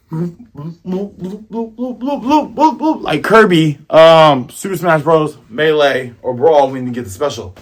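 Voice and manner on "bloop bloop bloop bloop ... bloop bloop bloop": bloops climbing steadily in pitch; imitated inflating bloops.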